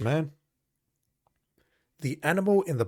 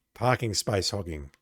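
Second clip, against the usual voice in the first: usual speaking voice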